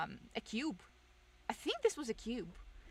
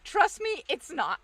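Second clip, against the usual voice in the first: mock insistence; 7 semitones higher, 8 dB louder, slower than usual. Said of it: "and then I'm just like".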